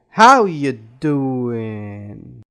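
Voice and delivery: "how" punched loud, "doing" stretched out; stress on HOW. Shown as a demonstration. Teacher giving an example of stress, loud "how" and long "doing". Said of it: "or even changing the volume".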